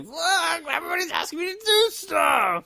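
put-on high, fast, whining teenager; whiny teen. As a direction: shout it like a fed-up kid. Imitating an exasperated teen.

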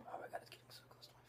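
whispered, scared of the brush; nervous whisper. Real, not acted. Friend doing her eyeliner, nervous whisper. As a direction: breathe it out as the liner gets close.

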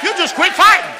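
very high, full-force preacher shout; shouted exhortation. Real, not acted. Preacher repeats his plea to stop fighting, high full-voiced shout. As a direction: shout it out to drive the point home.

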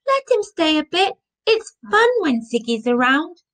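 high-pitched eager pleading; pleading child. A narrator's reading. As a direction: coax Dad to give in. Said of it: "pleaded Zoe".

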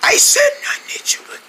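high, strained whisper; unintelligible lost voice. Acted. King repeating himself to helper, squeaky whisper. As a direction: push words out through a failed voice. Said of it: "I didn't understand that".

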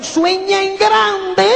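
despacio y enfático, con voz cambiada; pronúncialo como un lema motivador; consigna citada. Retold or imitated. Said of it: "como le dijeron". (repitiendo el lema con énfasis lento)

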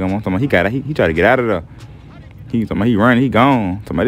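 ordinary talk, nothing marked

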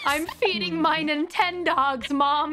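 put-on high, slow whiny kid. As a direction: whine it like a child caught gaming.

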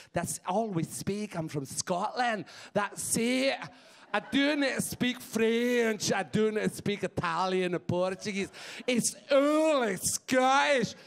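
put-on Scottish accent, indignant and stretched out; angry Scottish accent. Comic telling the crowd what Scots speak, indignant Scottish accent. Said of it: "that's what they speak in Scotland".

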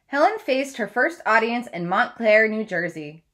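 steady read-aloud storytelling voice; story narration. Acted. Teacher reads biography aloud.

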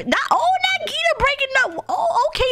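high-pitched dismayed reaction; shocked exclamation. Real, not acted. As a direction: exclaim in high-voiced disbelief. Reacts to Gita's breakup news.

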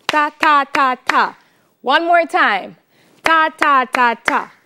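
ta ta ta ta counted on the beat; rhythmic ta syllables for the class. Shown as a demonstration. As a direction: speak the rhythm as even ta syllables. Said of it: "The rhythm goes like this".

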